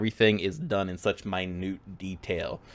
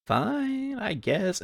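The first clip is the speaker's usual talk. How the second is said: higher, softer grudging reply; reluctant child; 11 semitones higher, 5 dB quieter, much slower than usual. Acted. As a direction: give in sulkily. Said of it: "so Jeffrey just goes".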